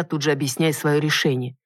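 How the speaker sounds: ordinary delivery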